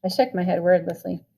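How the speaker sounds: ordinary delivery, nothing marked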